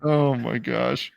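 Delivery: oh my gosh, cracking up; laughing. Real, not acted. Reacting to a joke.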